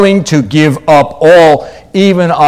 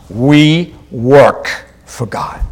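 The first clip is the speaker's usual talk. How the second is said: slow, weighty "We work for God"; each word of "We work for God" drawn out and separated; emphatic; slower than usual. Real, not acted. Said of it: "just want to emphasize that".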